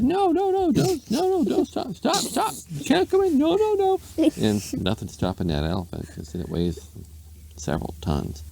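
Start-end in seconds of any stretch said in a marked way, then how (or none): 0.0-4.0 very high squeaky pleading; frantic lady. A narrator's reading. Lady trying to stop the elephant entering, frantic high squeal.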